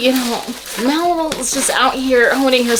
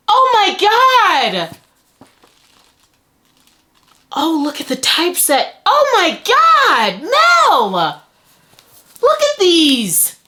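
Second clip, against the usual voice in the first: high, swooping, falling exclamations; awed excitement; 7 semitones higher, slower than usual. Real, not acted. Vlogger amazed by binder Mel's books, awed squeals. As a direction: gush in stretched-out high cries of amazement.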